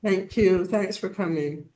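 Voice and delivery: normal talking voice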